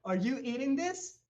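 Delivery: high, loud rising question; high-pitched question to another diner; high character voice. Acted. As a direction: ask eagerly in a high put-on voice. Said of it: "le dice".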